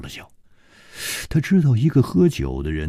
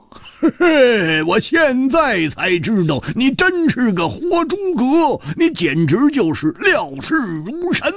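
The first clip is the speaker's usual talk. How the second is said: blustering Hu Tiehua, admiring. Acted. (高声拖长、惊叹地夸赞)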